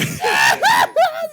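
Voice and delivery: high shrieking burst of laughter; loud laughing. Real, not acted.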